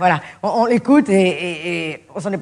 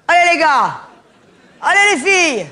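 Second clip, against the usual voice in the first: cheering on; 6 semitones higher, slower than usual. Acted. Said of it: "team pep talk".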